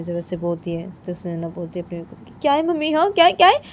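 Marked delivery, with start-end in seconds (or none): 2.4-3.6 high, loud, whiny pleading; protesting, pleading daughter. Acted. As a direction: beg papa to step in, voice raised high. Daughter pleads with mother and father. (ऊँची आवाज़ में गिड़गिड़ाती बेटी)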